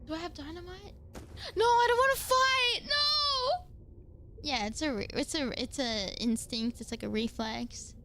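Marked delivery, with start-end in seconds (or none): 1.5-3.6 high, loud panicked yell; panicked shout. Real, not acted. Panicking at attacking creature.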